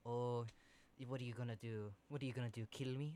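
lightly voiced tough boss, low and soft; quiet, understated threat from a big boss; a hint of a hard gangster boss; hard mob boss. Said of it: "there's this big hard boss".

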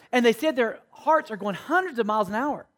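ordinary talk, nothing marked

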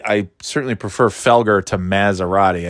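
normal talking voice

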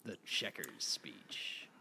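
slow, hushed whisper; mock-reverent whisper. Co-host echoing the speech's name, whispered. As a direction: whisper it slowly.

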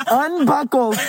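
high giggling through the word; laughing while talking. Real, not acted.

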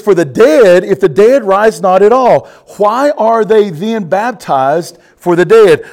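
loud, ringing preacher's scripture read; booming pulpit reading. Acted. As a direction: proclaim the verse to the congregation. Preacher reads scripture aloud.

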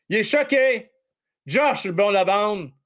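ordinary talk, nothing marked

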